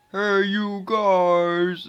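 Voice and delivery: long, loud, high-pitched call; movie-quote holler. Acted. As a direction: holler it out, stretching every word. Quotes Goonies with a drawn-out call.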